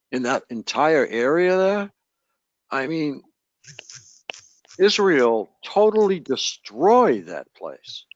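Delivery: ordinary talk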